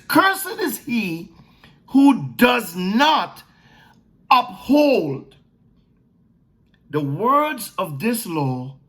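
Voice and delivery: slow, weighty, each phrase spaced out; slow solemn proclamation; solemn scripture reading. Acted. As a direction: read it like the law itself, deliberately. Reads scripture slowly, weighty.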